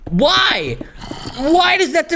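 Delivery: usual speaking voice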